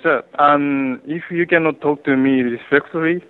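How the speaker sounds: normal talking voice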